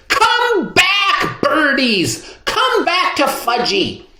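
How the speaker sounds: put-on high toddler scream after the birds. A narrator's reading. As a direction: shriek for the pigeons to come back, high. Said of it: "was screaming".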